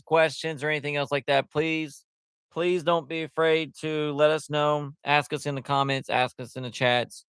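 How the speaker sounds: ordinary delivery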